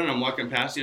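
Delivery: ordinary talk, nothing marked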